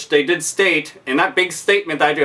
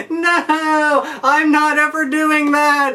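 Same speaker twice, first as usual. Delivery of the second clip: mock defiant self; 8 semitones higher, slower than usual. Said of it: "And I'd be like".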